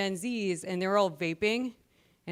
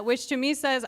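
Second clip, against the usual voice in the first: ordinary delivery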